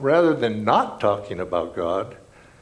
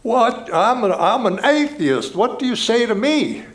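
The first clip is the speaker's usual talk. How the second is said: put-on heavy Australian accent, higher and louder, challenging; 10 semitones higher, 6 dB louder than usual. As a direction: play a blunt Australian atheist calling out a question. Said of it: "put on this very heavy Australian accent".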